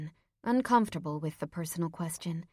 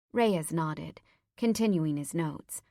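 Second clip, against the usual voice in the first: ordinary delivery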